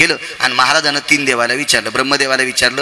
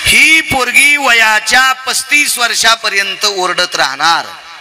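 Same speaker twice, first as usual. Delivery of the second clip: proclaimed divine verdict; 5 semitones higher, slower than usual. Acted. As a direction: pronounce a doom to the congregation, stretching the words. Preacher proclaims the gods' verdict. (कीर्तनकाराची संथ, ठासून केलेली घोषणा)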